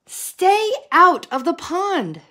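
loud, high yell; yelling frog. A narrator's reading. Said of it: "yelled Milton".

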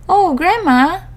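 put-on high, slow wondering girl; little girl voice. A narrator's reading.

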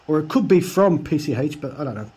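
ordinary talk

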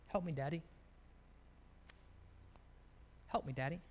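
small, low and very soft plea; imitated tearful child. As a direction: retell a crying child's hushed surrender. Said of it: "and she'd be crying".

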